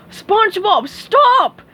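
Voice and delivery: very high, loud urgent stop; shout. Acted. Mister Krabs stopping SpongeBob, loud shout. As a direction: shout him to a halt. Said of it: "shouted Mister Krabs".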